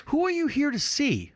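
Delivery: lightly voiced desk clerk asking; receptionist voice. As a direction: play the confused receptionist.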